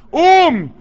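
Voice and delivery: high, loud قوم; rally the crowd to rise; crowd-rousing cry; shouted refrain. Acted.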